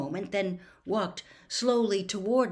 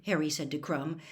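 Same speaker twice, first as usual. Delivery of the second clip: ordinary delivery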